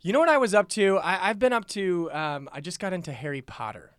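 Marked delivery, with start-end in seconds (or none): none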